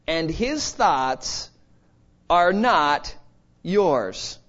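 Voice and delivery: "not" weighted, drawn out slowly; slow, stretched emphasis. Shown as a demonstration. Preacher drives home God's ways are not ours, slowly. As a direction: let each word land, slowly. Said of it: "is emphatic".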